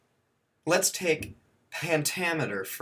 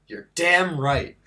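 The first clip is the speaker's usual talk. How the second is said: emphatic; 3 semitones higher, 7 dB louder than usual. Acted. Answering his own question.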